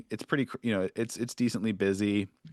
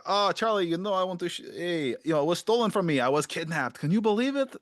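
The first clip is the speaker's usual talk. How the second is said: higher, boastful storyteller; showy Dave voice; 8 semitones higher than usual. Acted. Dave boasting to Charlie, higher and showy. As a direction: brag about the kidnapping. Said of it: "He's like".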